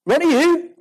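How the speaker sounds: high-pitched mock bafflement; incredulous question. Moped rider unimpressed by the driver, acted incredulity. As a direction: query the name like it means nothing.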